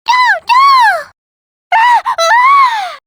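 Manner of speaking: high, loud frightened shouts; terrified crying out; panicked cry. Acted. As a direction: scream as if being grabbed. Character in danger, crying out.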